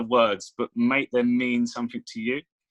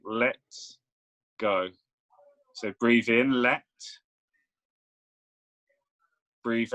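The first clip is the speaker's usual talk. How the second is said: measured meditation voice with long pauses; guided meditation. Real, not acted. Coach guiding a client's breath and imagination, guided meditation.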